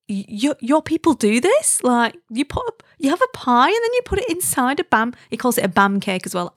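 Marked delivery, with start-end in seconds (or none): 0.1-5.1 stammering, high-pitched incredulous horror; mock utter disgust. Southern husband appalled by pie in bread, mock disgust. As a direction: imitate an appalled outsider questioning the locals. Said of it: "with utter disgust".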